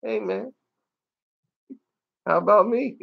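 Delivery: mock-meek little voice of the one left behind; forlorn dinosaur. Voicing the dinosaur watching the boat leave without him, comic forlorn plea. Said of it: "he was just like".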